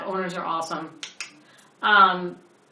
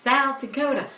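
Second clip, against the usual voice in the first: higher-pitched mock Southern twang; bad Southern accent; 7 semitones higher, 4 dB louder than usual. Showing co-host the man's speech, bad Southern accent. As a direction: repeat it in a hammed-up Southern accent. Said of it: "Your horrible Greek".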